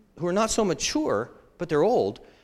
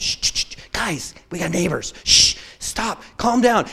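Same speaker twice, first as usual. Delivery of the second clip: put-on hushing rowdy kids, firmer at the end; acted-out grandparent shushing; urgent shushing. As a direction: shush them, then firmly settle them down. Acts shushing rowdy grandkids in apartment.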